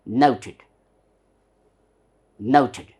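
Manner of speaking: NOUTED stressed on NOU, said as "ow"; British "nouted", stress up front; stress on first syllable. Shown as a demonstration. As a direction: hit the first syllable of "nouted", let "tid" drop. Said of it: "you stress on that, it becomes".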